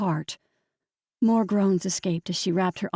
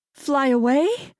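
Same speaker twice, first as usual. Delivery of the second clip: wondering; 5 semitones higher, 4 dB louder, slower than usual. A narrator's reading. Realizes bird's message.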